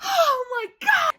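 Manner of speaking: high-pitched, squealed exclamation; overwhelmed squeal. Real, not acted. Reader delighted by romantic line, high squeal.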